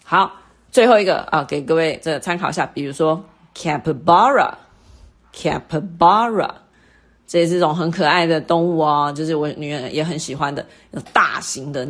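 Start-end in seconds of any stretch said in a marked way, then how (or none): 3.5-6.5 capybara slowly, BA lifted and long; stress on third syllable. Shown as a demonstration. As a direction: say capybara with the peak on BA. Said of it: "最高音的音节是在ba".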